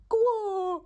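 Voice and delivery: very high squeaked frog croak; a sudden frightened croak; startled croak. Acted. Frog falls on its back, high startled croak. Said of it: "розгублено кумкувало".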